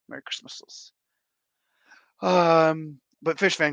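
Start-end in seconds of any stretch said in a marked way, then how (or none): 0.1-0.9 soft unvoiced whisper; whispered Merry Christmases. Shown as a demonstration. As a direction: breathe the greeting under your voice. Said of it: "same inflection".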